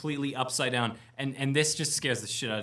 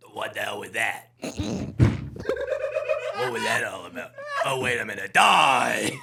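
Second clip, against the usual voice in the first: gravel-voiced mummy; 2 semitones lower, 6 dB louder, slower than usual. Mummy remembers it is fighting, gravelly and building to a roar. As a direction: gravelly growl building to a loud shout. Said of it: "and gravel".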